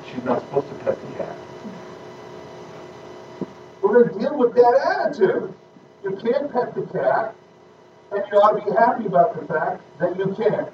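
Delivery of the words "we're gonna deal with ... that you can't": put-on higher, firm scolding of a child; stern mom. Mother scolds her crying daughter about the cat, stern and raised. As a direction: lay down the law to the little girl.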